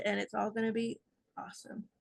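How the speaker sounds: ordinary talk, nothing marked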